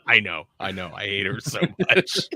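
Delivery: laughing. Real, not acted. Laughing defense of her character.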